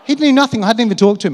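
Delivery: mock outrage at the crowd; indignant exclamation. Real, not acted. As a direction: exclaim in disbelief. Indignant aside to audience.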